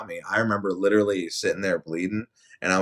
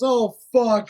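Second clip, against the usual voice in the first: high, loud exasperated curse; dismayed curse; 9 dB louder than usual. Retold or imitated. Manager reacting to the worker's request, dismayed curse. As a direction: blurt it in dismay.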